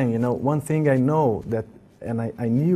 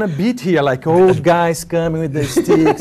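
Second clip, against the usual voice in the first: laughing; 4 semitones higher, 8 dB louder than usual. Real, not acted. Joking about bodyguard duty.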